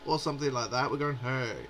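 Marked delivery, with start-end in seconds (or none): 1.2-1.7 low, understated "hey"; ordinary bloke. Shown as a demonstration. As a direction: say it low and offhand, just a bloke. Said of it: "It's just a bloke".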